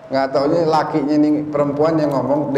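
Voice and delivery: ordinary talk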